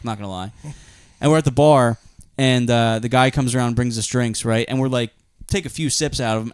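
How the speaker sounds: ordinary talk, nothing marked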